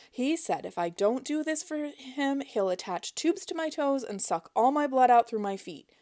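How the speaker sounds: high, pleading put-on fear; mock panic. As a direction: lay the fake terror on thick. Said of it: "high-pitched panic she could muster".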